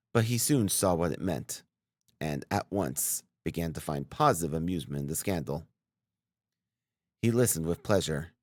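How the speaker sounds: usual speaking voice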